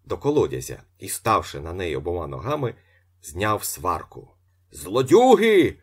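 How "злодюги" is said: loud, high-pitched janitor's outcry; wailing shout. A narrator's reading. Janitor denouncing the thieves to the courtyard, wailing shout. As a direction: holler it out to the whole yard. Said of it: "голосував двірник".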